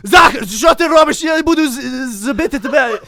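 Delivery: high, loud, hammed-up foreign yelling; mock Ukrainian shouting. Drunk storyteller fakes his Ukrainian shouting, loud and high. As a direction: bellow gibberish-sounding Ukrainian like a drunk. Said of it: "but it sounds like".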